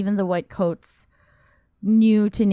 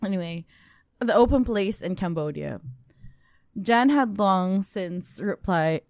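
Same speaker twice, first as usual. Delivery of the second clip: ordinary delivery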